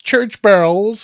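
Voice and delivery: overdone American r's, as a mocking question; mock American accent. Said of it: "trying to put on an American accent".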